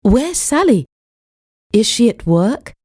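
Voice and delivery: ordinary delivery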